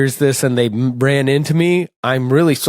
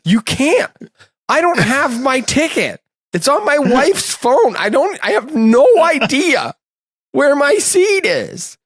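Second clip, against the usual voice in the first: high, hammed-up helpless pleading; mock panicked; 11 semitones higher than usual. Telling security he has no ticket, high mock panic. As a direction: plead with security, pitched up and lost. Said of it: "And I was like".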